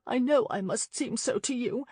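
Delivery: a lightly voiced young lady, blurting in distress; blurted helplessly. A narrator's reading. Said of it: "she blurted helplessly".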